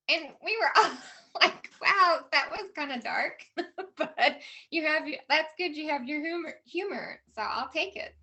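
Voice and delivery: loud, high, laughing through the words; laughing reaction to dark humor; amused laughing. Retold or imitated. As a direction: retell it through bursts of laughter. Laughs retelling son's dark joke.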